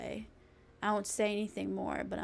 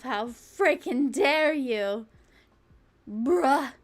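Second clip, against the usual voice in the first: mock outrage; 3 semitones higher, 7 dB louder, much slower than usual.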